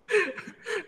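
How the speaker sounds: bright high burst of laughter. Real, not acted. Preacher laughs at his knife joke, high burst. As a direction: laugh at your own joke.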